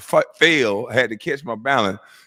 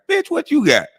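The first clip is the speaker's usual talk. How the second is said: incredulous. Real, not acted. Mocking the streamer.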